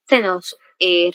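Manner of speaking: ordinary talk, nothing marked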